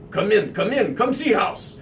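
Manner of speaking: lightly put-on Russian accent; a hint of a Russian homeowner at the door. Shirtless owner greeting the realtor in a light Russian accent. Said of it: "thick Russian accent".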